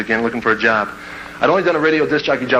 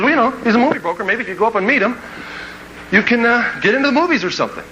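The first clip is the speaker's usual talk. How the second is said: higher-pitched dad offering advice; 8 semitones higher than usual. Acted. Dad urging his kid to meet the broker, high-pitched advice. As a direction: voice a dad making a suggestion, pitched well up. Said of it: "My dad says".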